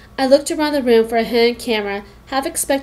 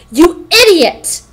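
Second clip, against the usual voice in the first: loud, slow venomous hiss; 6 semitones higher, 8 dB louder, much slower than usual. A narrator's reading. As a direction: spit it at the new girl. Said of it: "she hissed".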